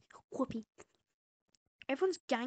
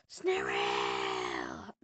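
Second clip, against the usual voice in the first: growl. Pretend. Mimics creature growl.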